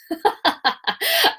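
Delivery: a high, teary little laugh. Real, not acted. Laughs after getting emotional.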